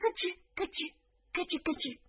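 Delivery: crunching noise. Acted. Rabbits munching carrots.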